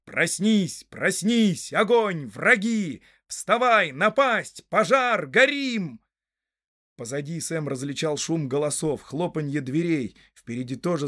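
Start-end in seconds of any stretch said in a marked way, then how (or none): 0.0-6.0 loud, high cries rising in pitch; alarm call. A narrator's reading. Horn signal rousing villagers to fire and foes, alarm call. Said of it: "трубил уже старинный сигнал".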